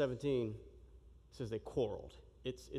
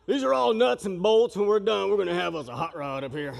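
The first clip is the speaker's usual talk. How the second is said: booming tough-guy brag, higher and much louder; macho guy voice; 3 semitones higher, 10 dB louder than usual. Pretend. Preacher hams up a manly voice for the congregation, booming. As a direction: puff up like a gearhead showing off. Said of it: "Wasn't a very manly illustration".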